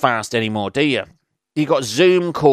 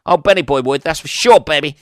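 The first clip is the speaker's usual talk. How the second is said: hyped up; 3 semitones higher, 7 dB louder than usual. Real, not acted. Host bragging to listeners, hyped and loud. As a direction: pump it up like a hype man.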